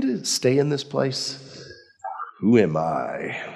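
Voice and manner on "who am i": low, slow Who am I; a deep, humbled murmur; humble question. Retold or imitated. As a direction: let it sink low and slow, in awe. David's humble question repeated.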